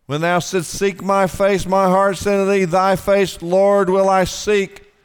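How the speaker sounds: loud proclaimed verse, slowing at the end; forceful scripture reading. Acted. Preacher rereads the psalm verse to the congregation, loud. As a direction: ring out "Thy face, Lord, will I seek".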